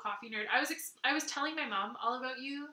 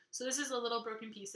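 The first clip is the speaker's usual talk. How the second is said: normal talking voice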